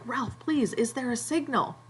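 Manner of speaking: Piggy begging Ralph for an answer, half performed; pleading. Retold or imitated. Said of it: "he's like".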